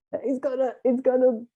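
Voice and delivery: mock scream. Passenger imitating the crowd's panicked scream. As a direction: mimic a panicked passenger yelling. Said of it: "some of us just scream".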